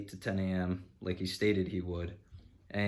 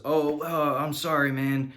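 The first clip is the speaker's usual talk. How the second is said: higher, louder mock apology; imitating the mechanic; 6 semitones higher, 5 dB louder, slower than usual. Mechanic excusing himself on the phone to the customer, mock-apologetic. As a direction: put on the flaky mechanic's sorry voice.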